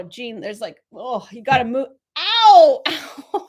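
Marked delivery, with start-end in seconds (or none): 2.2-2.8 sharp, loud, high ow of pain; sudden pain yelp. Real, not acted. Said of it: "I just hit my elbow". 2.9-3.5 ow half laughed out after the bump; laughing ow. Real, not acted. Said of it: "I just hit my elbow".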